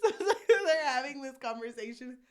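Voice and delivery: loud, giggly voice rising with amusement; real laughter through the words; laughing. Real, not acted. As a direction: crack up while getting the words out. Laughing at her own silly idea.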